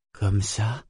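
mildly hesitant question. A narrator's reading. Man unsure how to touch her, asking hesitantly. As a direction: ask with a touch of uncertainty. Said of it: "demanda-t-il hésitant".

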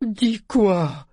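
high, hard demand; pitiless. A narrator's reading. Ship demands the news from the woman, pitiless. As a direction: press for the answer without mercy. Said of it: "fit-il impitoyable".

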